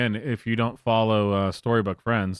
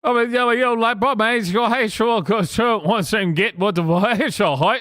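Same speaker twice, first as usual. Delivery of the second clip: high, louder put-on Cockney street tough voice; mock Cockney accent; 11 semitones higher, 4 dB louder than usual. Streamer imitating [name] with a mocking Cockney accent. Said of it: "[name] with the Cockney accent".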